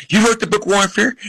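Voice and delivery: high-pitched voice of an admiring lady; imitated eager fan. Lady running up to author on street, imitated eagerness.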